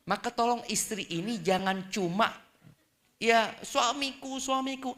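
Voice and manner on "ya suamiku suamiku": higher, slow wailing lament; mock lament. Wife helplessly lamenting her husband, mock and slow.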